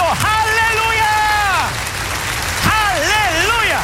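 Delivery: ecstatic hallelujah. Real, not acted. Preacher exclaiming to congregation.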